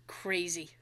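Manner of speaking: breathy whispered aside; whispered verdict. Real, not acted. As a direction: drop to a whisper.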